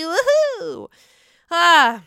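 high whooping cheer; gleeful high-pitched whoop; excited cheer. Real, not acted. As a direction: celebrate it with a loud woohoo. Celebrating her new website.

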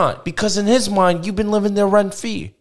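high, insistent mock mobster accent; Ben Soprano. Host warning caller about her money, in a mobster accent. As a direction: lay down the verdict like a New Jersey wise guy. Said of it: "as Ben Soprano".

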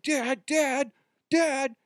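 put-on high, frantic child crying out; imitating a scared son calling out for his dad; panicked kid calling. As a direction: call for dad in mock panic.

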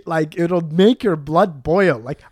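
usual speaking voice

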